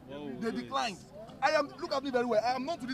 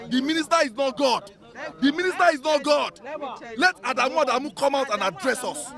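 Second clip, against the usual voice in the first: raised, insistent protest voice; loud demand; 5 semitones higher, 7 dB louder than usual. Real, not acted. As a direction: push it hard like an angry protest leader. Student leader confronting official loudly.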